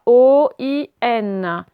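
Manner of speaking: O I N spelled slowly, letter by letter. Shown as a demonstration. Teacher spelling O I N slowly for pupils, letter by letter. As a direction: spell out O I N, each letter distinct. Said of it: "c'est dans cet ordre-là".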